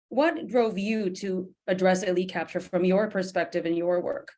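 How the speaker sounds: ordinary talk, nothing marked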